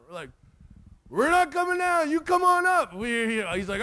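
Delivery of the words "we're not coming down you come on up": put-on high, raised kid's comeback; mock defiant yell. Boys up the tree answer the bully, mock defiant yell. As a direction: call back down at the bully, acted. Acted quarrel with bully below.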